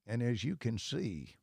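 imitating the Texan, long pause before "I have done that"; slow drawl with eternal pause. Retelling the Texan fan for the hosts, a mild slow Texas drawl. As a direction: drawl it, then hold a huge pause. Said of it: "I'm gonna do it just like he did".

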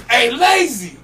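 loud, high hail; calling out. Retold or imitated. Roadie hailing the rapper, calling out. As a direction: call a friend's attention.

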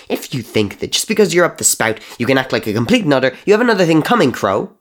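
high, loud screech; shrieking scold rising to the top. Acted. Murdoch screeching back at Crow, high and loud.

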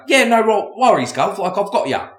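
high, eager mock British accent. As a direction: chirp it as a keen British bloke. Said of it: "Then they go to the U K guy".

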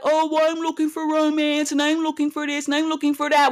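high mocking voice of a hopeful suitor. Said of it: "and then you're like".

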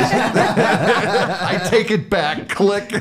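high, drawn-out words through laughter; laughing. Real, not acted. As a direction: relish the punchline while cracking up. Repeats punchline amid laughter.

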